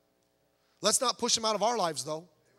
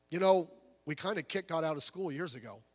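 soft, lower, gentle reply; very kindly; 5 semitones lower, 7 dB quieter than usual. Retold or imitated. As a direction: quietly voice a kind missionary's answer. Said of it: "the missionary very kindly said".

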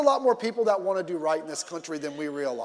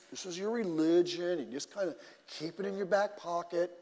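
softer, offhand dismissiveness; mock indifference; 3 semitones lower, 9 dB quieter than usual. Preacher mimicking a half-hearted believer, mock indifference. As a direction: play down faith with a quiet, casual shrug in the voice.

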